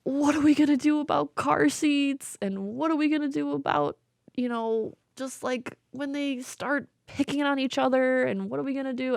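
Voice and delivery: high, anxious rush of questions; mock panicked parent-to-be; worried questions. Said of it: "We're like".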